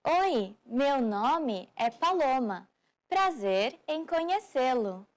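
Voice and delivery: "Oi, meu nome é Paloma" said slowly, each word spaced out; slow model. Shown as a demonstration. Teacher slowing the phrase down for learners, slow and careful. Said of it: "Start by saying".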